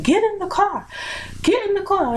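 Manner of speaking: high-pitched imitation of an insistent mom; mom ordering. Mother driving alongside her walking daughter, imitated ordering. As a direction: give a repeated order, pitched well up.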